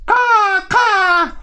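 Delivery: high loud cawing; crow caw. Acted. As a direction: squawk like a crow. Crow calls.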